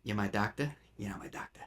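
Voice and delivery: put-on lightly done Brooklyn New York accent, low and soft; a quiet hint of a Brooklyn cabbie. Quoting Jake's line in a mild Brooklyn accent. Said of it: "a specifically, like, Brooklyn, New York kinda accent".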